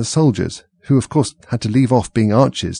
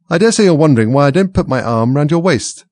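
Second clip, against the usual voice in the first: higher, louder duchess voice; a hint of a fussy duchess; lightly voiced duchess; 6 semitones higher, 6 dB louder than usual. A narrator's reading. Duchess speaking to Alice.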